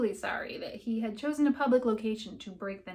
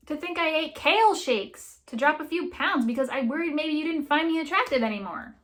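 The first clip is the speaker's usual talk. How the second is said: higher, louder, tumbling out with a smile; slightly manic; 5 semitones higher, 5 dB louder than usual. Acted. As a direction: rant with a manic grin. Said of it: "her smile slightly manic".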